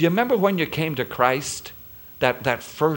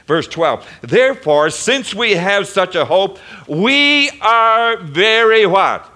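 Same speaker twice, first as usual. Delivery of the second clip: full-force preaching; 6 semitones higher, 7 dB louder than usual. Acted. Pastor proclaims God's mercy to congregation, full preaching force. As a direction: lift it to a preaching peak.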